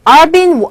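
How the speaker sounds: "I've been" called out loud; loud pronunciation drill. Shown as a demonstration. Teacher drilling "I've been" for students. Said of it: "발음이 I've 이잖아요".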